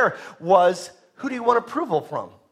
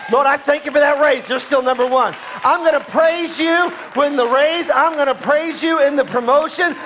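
exuberant praise; 7 semitones higher, 4 dB louder than usual. Acted. Believer praising God on top.

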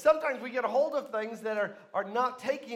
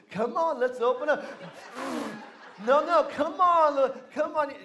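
loud, higher mock-mother voice; coaxing mom; 5 semitones higher, 9 dB louder than usual. Mom spoon-feeds her twenty-five-year-old, hammed-up coaxing. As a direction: exaggerate the spoon-feeding coaxing, loud and high. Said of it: "your mom's doing".

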